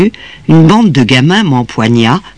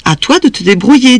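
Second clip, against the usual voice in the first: high-pitched, lightly acted maternal brush-off; impatient mother, pitched up; dismissive mother; 10 semitones higher than usual. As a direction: send the child off with no sympathy. Mother sends scared child back out.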